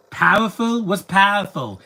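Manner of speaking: "Powerful" said high and loud with the vowel squashed to "pah-ful"; mocking a mispronunciation. Mocking the movie actor's way of saying it, high and loud. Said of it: "See, they say things wrong".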